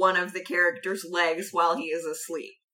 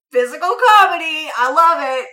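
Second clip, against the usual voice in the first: gleeful; 8 semitones higher, 10 dB louder than usual. Retold or imitated. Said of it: "but I was just like".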